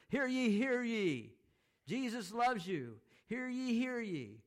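loud, high, drawn-out street-corner cry; herald's shout. Acted. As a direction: shout it out slowly like a town herald. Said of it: "shouted those kind of announcements".

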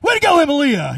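high, loud happy cheer; hammed-up sporting cheer for the winner; cheering as Bob. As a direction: shout a big congratulations for him. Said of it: "He's like".

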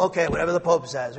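quick, dismissive parroting of a follower; mock blind obedience. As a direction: rattle it off like a mindless yes-man. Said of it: "That's blind obedience".

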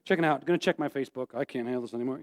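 low, soft, resigned mumble; checked-out listener. Retold or imitated. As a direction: imitate someone quietly giving up. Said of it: "Some of you have shut down".

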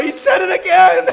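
high, loud, eager tattle; excited tattling. Retold or imitated. Boy tattling to mom about his brother, excited. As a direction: run in shouting the news.

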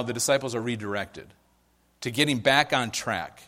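ordinary delivery

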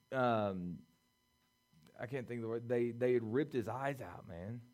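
ordinary delivery

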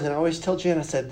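ordinary delivery

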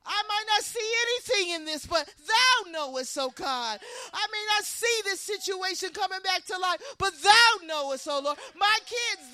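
loud, high, fervent preaching build; full-force preaching. Acted. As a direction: pound each "thou knowest" like a call to faith.

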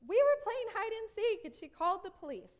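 high, loud retold complaint; indignant child's protest. Kids explaining the police threat to mom, indignant. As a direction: protest innocence, pitched well up.

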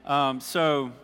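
ordinary delivery

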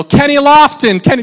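loud high call across a distance; calling out a name. Retold or imitated. Fan calling to a famous player, loud call. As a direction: shout his name to catch his eye. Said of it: "you call out their name".

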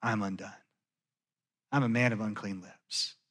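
low, soft, broken confession; hushed woe-is-me; quiet lament. Retold or imitated. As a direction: say it softly, undone before God. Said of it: "Like Isaiah, I say".

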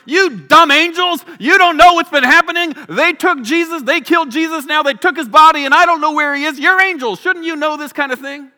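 loud, high-pitched indignant rant, acted out; angry outburst. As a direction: berate them as an exasperated, grieving Mary. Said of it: "not burying her anger".

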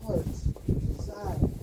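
slow, breathy whisper of the phrase; whispered echo. Shown as a demonstration. Preacher echoing the verse to his congregation, whispered slowly. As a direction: let it fall to a slow hush.

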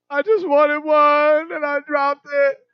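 put-on high, slow small-boy confession; child confessing. Father voices son admitting he dropped the dish, slow and high. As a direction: own up like a sheepish little kid. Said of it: "son confesses".

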